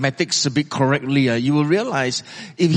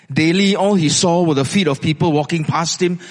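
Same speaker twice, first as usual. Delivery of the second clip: ordinary talk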